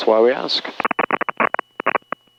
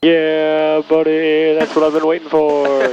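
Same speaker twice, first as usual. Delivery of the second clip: raised, drawn-out cheer; gleeful celebration; 6 semitones higher, slower than usual. Pretend. Co-pilot celebrates the awaited radio line, gleeful. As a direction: savour it high, like a long-awaited treat.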